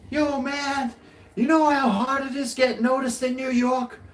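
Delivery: high, excited hype voice; hyped street guy. Retold or imitated. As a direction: pump him up like a fan. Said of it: "he goes".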